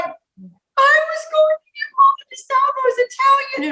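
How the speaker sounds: whiny protest. Retold or imitated. Wife protesting, high pitched quote.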